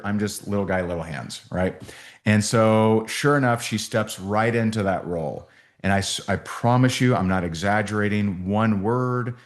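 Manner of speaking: ordinary delivery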